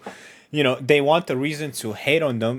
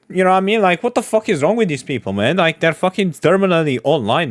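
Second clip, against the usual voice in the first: normal talking voice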